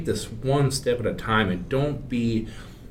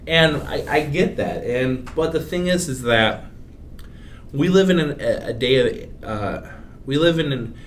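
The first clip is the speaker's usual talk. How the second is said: too much caffeine; 5 semitones higher, 3 dB louder than usual. Real, not acted. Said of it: "couple extra cups of coffee today".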